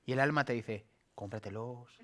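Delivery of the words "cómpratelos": much softer, coaxing "Cómpratelos"; soft sly inner voice urging; tempting soul. Retold or imitated. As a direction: purr it like a temptation. The soul urges buying shoes.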